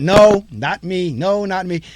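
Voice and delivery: loud, slow, insistent no; mock denial. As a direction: wave it off like a doubting audience member. Audience members denying a reading.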